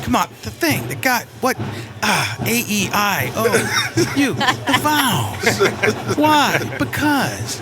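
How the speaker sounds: a hint of Biden, laughing; Biden impression. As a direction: stumble through it like old Biden. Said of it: "with Biden".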